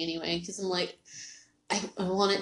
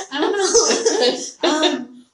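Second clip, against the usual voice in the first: laughing, high and louder; 7 semitones higher, 7 dB louder, much slower than usual. Real, not acted. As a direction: laugh through the words. Laughing after spitting on herself.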